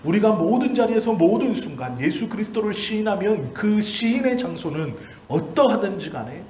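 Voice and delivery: high, loud, impassioned pulpit cry, pressing each clause; preaching at full force. Acted. Pastor to his congregation on shame and glory, fervent full-force preaching. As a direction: preach it out to the back row.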